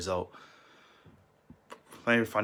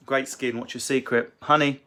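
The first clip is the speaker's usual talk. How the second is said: usual speaking voice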